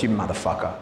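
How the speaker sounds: a gruff low dog muttering a curse; low mock dog voice. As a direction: play the dog cheated of its food, low and rude. Dog voiced cursing the trainer.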